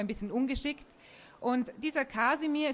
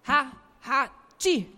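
sneeze; 4 semitones higher, 7 dB louder than usual. Acted. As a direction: sneeze loudly after a tickle. Tree tickled under the nose.

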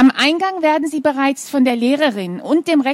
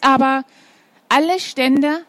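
usual speaking voice

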